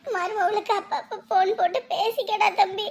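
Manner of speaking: high, tear-choked urging; tearful. Acted. Mother telling son to phone his wife, tearful. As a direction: urge him through tears. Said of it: "விடுங்க, விடுங்க, அழாதீங்க".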